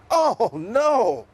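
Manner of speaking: high, loud cry of dismay; storybook zebra yelling in alarm; yelled. Acted. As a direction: yell as the clothes fly off. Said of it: "yelled Zippity".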